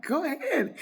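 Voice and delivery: high-pitched call to the next room; calling out permission. Retold or imitated. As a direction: sing it out high to your guests. Calls permission again from the phone.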